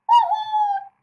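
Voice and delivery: very high, loud whoop; exuberant shout. A narrator's reading. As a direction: let out a triumphant whoop. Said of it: "she shouted".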